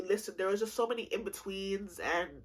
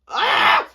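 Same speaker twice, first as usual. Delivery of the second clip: loud, high cry of loathing; exasperated scream; 16 dB louder than usual. Real, not acted. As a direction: burst out with a frustrated shriek. Frustrated cry on naming hated ex.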